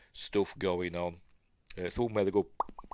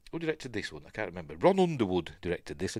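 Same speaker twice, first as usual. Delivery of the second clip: normal talking voice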